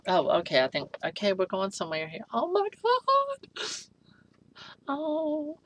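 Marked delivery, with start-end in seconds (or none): 2.3-5.6 high, slow, drawn-out oh my God; awestruck squeal. Real, not acted. Unboxing a doll, gushing in a high squeal. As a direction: swoon over the doll. Gasping at the doll's hair.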